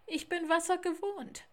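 high, loud assurance; confident call. Acted.

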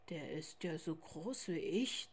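a big bear wondering to himself; polar bear father's thought. A narrator's reading. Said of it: "dachte auch Vater Eisbär". (tiefer, leiser Gedanke)

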